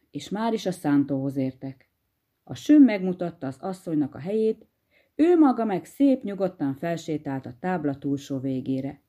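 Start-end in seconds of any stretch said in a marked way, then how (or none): none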